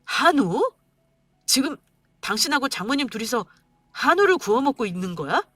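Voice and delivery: outraged shout at the price tag; angry husband confronting wife. Acted. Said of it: "고기 가격표를 보고 소리쳤습니다".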